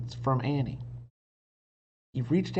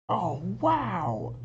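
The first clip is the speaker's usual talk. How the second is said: amazed; 7 semitones higher than usual. A narrator's reading. Annie amazed.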